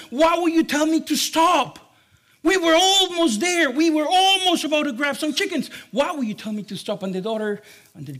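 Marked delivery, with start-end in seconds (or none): 0.1-1.8 loud, high, exasperated demand. Acted. Dad questioning his daughter after the false alarm, exasperated. 2.4-5.7 loud, high-pitched acted complaint; exasperated protest. Dad almost at the chickens, exasperated protest.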